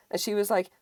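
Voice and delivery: ordinary talk, nothing marked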